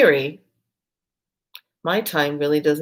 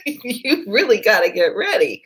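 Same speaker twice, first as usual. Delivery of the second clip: laughing through it; 5 semitones higher than usual. Real, not acted. Laughing while quoting him.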